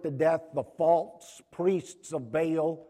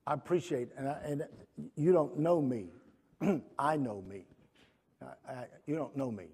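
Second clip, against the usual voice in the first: usual speaking voice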